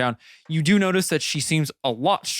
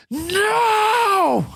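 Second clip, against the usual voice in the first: high, drawn-out scream of No; queen's scream; 5 dB louder than usual. Acted. Game master voicing the queen's cry, anguished scream. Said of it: "you just hear the queen s-, scream".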